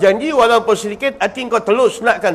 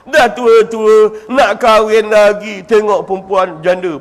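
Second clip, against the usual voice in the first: very slow, stretched-out teasing; slow mocking drawl; 3 semitones lower, slower than usual. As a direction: stretch every word to mock him. Mocking old man's desire. (sindiran yang sangat perlahan)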